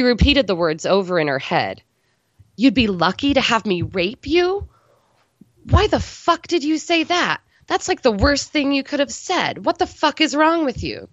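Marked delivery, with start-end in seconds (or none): 2.5-11.1 high, mocking self-rebuke; self-scolding. A narrator's reading. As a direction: scold yourself in disbelief. Amber berating herself inside.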